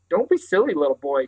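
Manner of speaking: ordinary delivery, nothing marked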